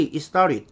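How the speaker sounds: "It started" quickly linked as "istarit"; native-speaker pronunciation. Shown as a demonstration. Teacher repeating the native reading for learners, linked and reduced. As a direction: drop the t's in "It started", flap into "i-starid". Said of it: "원어민들이".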